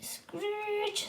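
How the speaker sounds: high soft screech; brake screech. Acted. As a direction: squeal it like brakes. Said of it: "sonaron los frenos".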